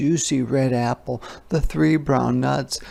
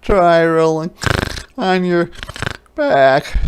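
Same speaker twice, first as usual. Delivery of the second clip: loud, higher piggy voice; loud snorty pig voice; snorting pig; 7 semitones higher, 7 dB louder than usual. A narrator's reading. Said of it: "snorted Pig".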